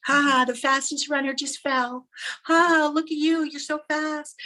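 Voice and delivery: high-pitched mocking taunt with fake laugh; jeering crowd. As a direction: jeer at the fallen runner. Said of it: "all these jeers".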